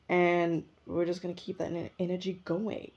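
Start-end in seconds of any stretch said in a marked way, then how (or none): none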